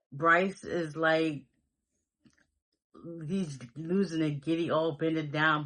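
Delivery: normal talking voice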